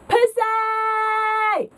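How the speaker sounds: "pussy" high with a long-stretched second syllable; mock heckle on "pussy", held long; exaggerated emphasis. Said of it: "so much emphasis on the word".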